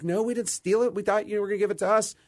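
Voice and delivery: high-pitched imitation of a protesting kid; retold child's protest. Retelling his answer to Dad about the gum, high mock protest.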